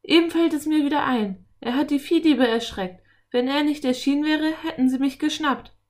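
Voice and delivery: high, loud rush of excitement; excited boy. A narrator's reading. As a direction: blurt out the memory as a thrilled boy. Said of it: "rief er aufgeregt".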